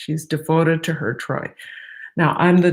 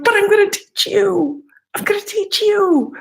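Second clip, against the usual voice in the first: much higher, louder, gleeful insistence; manic glee; 5 dB louder than usual. Retold or imitated. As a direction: tease the kids with manic excitement. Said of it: "I'm like".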